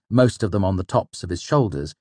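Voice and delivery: ordinary delivery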